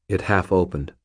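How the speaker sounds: plain reading voice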